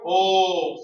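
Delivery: OH sounded long and loud; O sound held out loud. Shown as a demonstration. Teacher showing students where O is felt, demonstrated loudly. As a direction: hold a big open OH. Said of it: "The sound is here".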